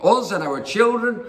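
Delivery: high-pitched, hammed-up grievance; complaining. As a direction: act out an aggrieved crowd accusing its leader. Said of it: "complaining to Moses".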